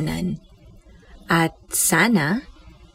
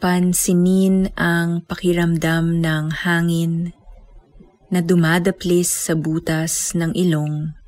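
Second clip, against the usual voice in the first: guided meditation. Acted. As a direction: guide them gently into stillness. Leads breath meditation.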